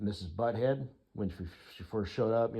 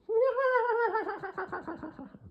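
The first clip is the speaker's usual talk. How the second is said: high-pitched braying whinny; zebra bray. Shown as a demonstration. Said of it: "That was my impression of a zebra".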